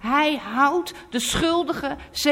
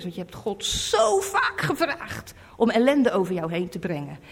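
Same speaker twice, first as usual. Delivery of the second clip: ordinary delivery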